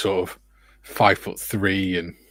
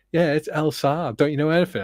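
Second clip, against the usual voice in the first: put-on higher, bratty kid voice; snotty kid; 8 semitones higher than usual. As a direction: play the know-it-all child, higher. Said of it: "And the kid's like".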